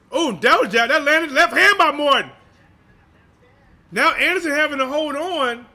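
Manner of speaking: excited ringside call. Real, not acted. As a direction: call the punch as it lands. Big punch lands in live call.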